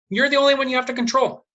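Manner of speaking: ordinary talk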